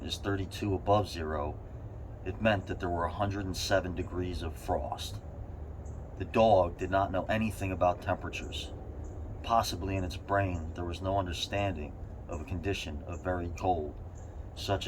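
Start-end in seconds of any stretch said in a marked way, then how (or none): none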